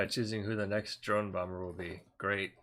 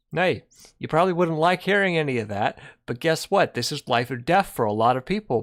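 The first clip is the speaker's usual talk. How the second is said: heated; 4 semitones higher, 9 dB louder than usual. Real, not acted. Heated rebuke to chat.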